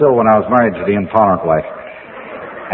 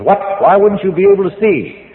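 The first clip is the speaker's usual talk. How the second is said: surprised; 8 semitones higher than usual. Retold or imitated. Psychiatrist baffled by answer.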